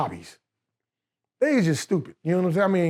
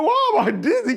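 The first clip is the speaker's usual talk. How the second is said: high-pitched, bewildered cry; 12 semitones higher, 3 dB louder than usual. Retold or imitated. Dizzy new convert wondering at himself while fasting, bewildered. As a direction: retell it in high, dazed confusion.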